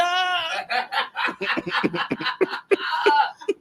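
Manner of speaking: high, tearful laugh; laughing and crying at once. Real, not acted. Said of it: "don't wanna cry on the show".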